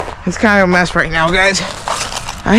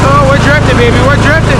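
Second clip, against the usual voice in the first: loud, high, thrilled whoop while drifting; excited shout; 7 semitones higher, 6 dB louder than usual. Real, not acted. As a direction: yell it out with pure glee, riding the slide. Drifting the tractor on ice.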